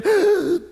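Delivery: sharp, high gasp; startled gasp. Retold or imitated. Showing the congregation a nightmare waking, a sharp high gasp.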